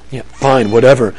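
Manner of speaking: low, sullen giving-in; acted sulky kid's compliance; grudging sibling. Grudging older sibling helping in acted example.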